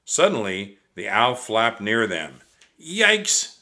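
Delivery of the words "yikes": high startled yikes; startled yelp. Acted. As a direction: jump at the owl flapping past. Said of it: "said Annie".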